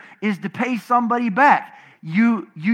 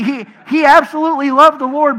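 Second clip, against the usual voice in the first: ordinary talk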